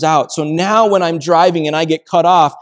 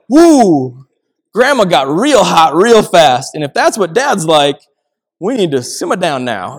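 startled realization; 2 semitones higher, 6 dB louder than usual. Retold or imitated. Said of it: "And I was like".

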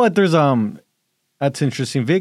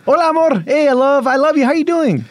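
much higher, louder gushing greeting; gushing answer; 9 semitones higher, 3 dB louder than usual. Acted. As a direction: answer the wife's call all sweet. Answering wife's role-play call.

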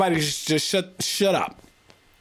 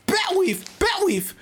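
raised-pitch mimicry of a Chinese accent; mock Chinese accent; 12 semitones higher, much slower than usual. Mocking a friend's Batweave, imitated Chinese accent. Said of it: "He's coughing over here, talking about".

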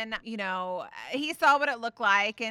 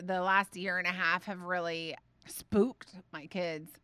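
ordinary delivery

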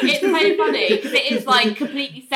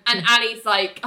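usual speaking voice

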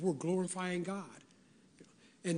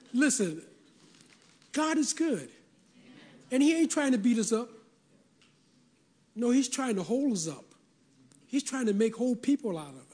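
higher, faster preaching build that climbs to the peak; fervent preaching; 4 semitones higher than usual. Acted. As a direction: drive the point home like a pulpit climax.

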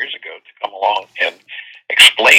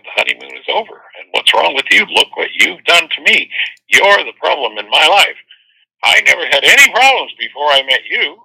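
higher, complaining lover's lament; mock blaming partner; 6 semitones higher than usual. Blaming the partner once the honeymoon is over, mock accusing. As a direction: voice a partner piling on the blame. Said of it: "they'll soon be singing the song of".

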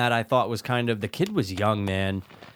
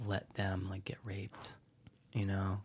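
unvoiced, much softer, dropping to a whisper; 4 semitones lower, 15 dB quieter, slower than usual. Real, not acted. Guessing about her kids, whispering the grim part. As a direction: hush it as if too dark to say aloud.

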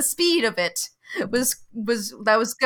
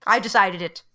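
ordinary talk, nothing marked

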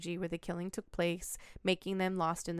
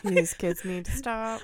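laughing; 4 dB louder, slower than usual. Real, not acted. Laughing at creepy kids.

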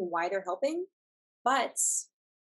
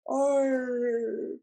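R sound pushed from the back of the throat; fake yawn; 4 semitones higher, 4 dB louder than usual. Shown as a demonstration. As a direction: yawn it out on a long R. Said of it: "let me see if I can do it".

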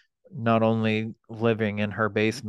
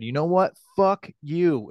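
higher, louder angry yell; retold angry yell; 8 semitones higher, 4 dB louder than usual. Son yelling back at his mother in front of the kids, angry yell. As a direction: snap it back at her in anger. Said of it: "yelled at her".

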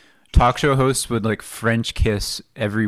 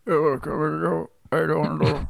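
put-on old lady voice; 5 semitones higher, 3 dB quieter, much slower than usual. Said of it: "This is how she actually said it".

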